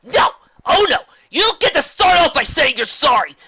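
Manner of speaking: high, loud shouted rage with a cracking voice; furious yelling. A narrator's reading. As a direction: scream it all out at her mentor. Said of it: "I need to yell at you".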